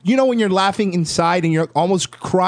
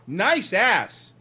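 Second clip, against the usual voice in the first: higher-pitched leering dad aside; mock-admiring dad, pitched up; 6 semitones higher, 4 dB quieter than usual. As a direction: imitate dad's shameless appreciative remark. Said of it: "and he goes".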